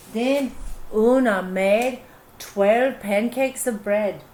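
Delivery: usual speaking voice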